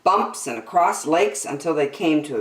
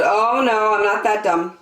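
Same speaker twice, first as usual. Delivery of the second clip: put-on higher, louder, emphatic kid; knowing refusal; 6 semitones higher, 4 dB louder than usual. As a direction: refuse firmly, as too smart to be tricked. Girl refuses the bait again.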